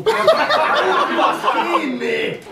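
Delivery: laughing, high, dragged-out parody shout; hammed-up drill yell. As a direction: stretch the order out high like a squeaky sergeant. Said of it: "Huutaa ja kiroilee".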